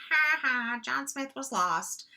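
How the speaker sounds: high, sing-song mock of a bad sentence; teasing high mock voice. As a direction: lampoon the sentence in a silly high voice. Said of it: "So both of these are like".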